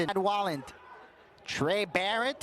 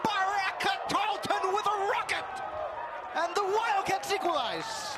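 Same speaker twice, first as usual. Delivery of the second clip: much higher, ecstatic goal call; long goal yell; 12 semitones higher than usual. Real, not acted. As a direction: ride the goal excitement.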